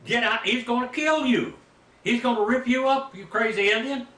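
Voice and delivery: high, loud mock yell of warning; hollering preacher. Pastor yelling at a reckless hunter, hollering warning. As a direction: bellow at him to get out of there. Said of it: "this preacher's hollering at me".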